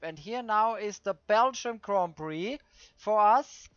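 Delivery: usual speaking voice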